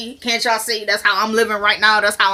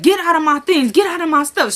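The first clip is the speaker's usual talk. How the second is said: higher, louder get out of my things; a retold furious get out; mock angry order; 7 semitones higher, 3 dB louder than usual. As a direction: order her out of your stuff. Veronica ordering her out.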